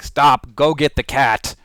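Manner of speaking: loud, urgent order to self; sudden self-command. Acted. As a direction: call out an abrupt order.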